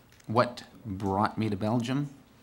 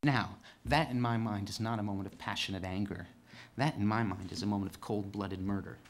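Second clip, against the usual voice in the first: hushed; 3 semitones lower, 6 dB quieter than usual. Real, not acted. Quiet after outburst.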